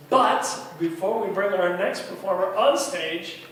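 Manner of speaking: ordinary talk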